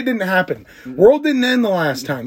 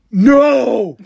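high, loud refusal; defiant no; 8 semitones higher, 6 dB louder than usual. Retold or imitated. Man refusing to leave the bathroom, loud no. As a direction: blurt a defiant no. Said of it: "And he goes".

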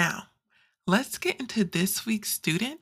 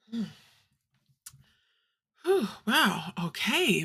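normal talking voice

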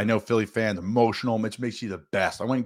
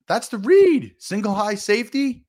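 emphatic; 10 semitones higher, 4 dB louder, slower than usual. Real, not acted. Emphatic football point.

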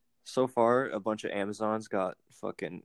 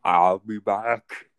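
put-on slow Austrian accent, louder; Arnold impression; 5 dB louder, much slower than usual. Guessing game, friend does a slow Austrian accent. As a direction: drag out the words in a heavy Austrian accent. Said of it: "It's Arnold Schwarzenegger".

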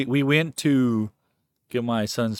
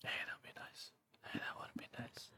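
hushed whisper; 24 dB quieter than usual. Real, not acted. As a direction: breathe it out under your breath. Whispered reply.

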